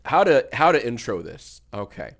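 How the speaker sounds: normal talking voice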